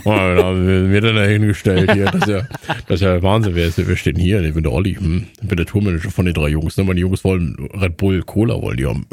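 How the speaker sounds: low put-on character voice; deep, hammed-up tour manager; Olli. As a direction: drop low into a jokey tour-manager impression. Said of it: "das ist meine Olli-Stimme".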